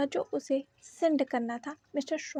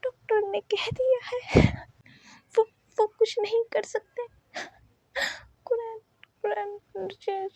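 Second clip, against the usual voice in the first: sobbing; 3 semitones higher than usual. A narrator's reading. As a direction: sob the words out, stammering in despair. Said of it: "फूट फूट कर रोने लगी". (ऊँची, टूटती रोती आवाज़ में धीरे-धीरे)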